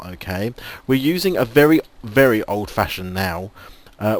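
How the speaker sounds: ordinary talk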